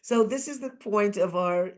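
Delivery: real tears pushing through the words; tearful. Real, not acted. Guest admitting she cries easily, tearful. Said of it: "I cry at the drop".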